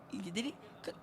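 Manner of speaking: ordinary delivery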